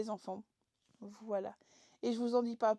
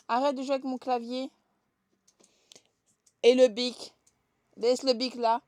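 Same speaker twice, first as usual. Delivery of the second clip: scolding; 9 dB louder than usual. Real, not acted. Scolding daughter at keyboard.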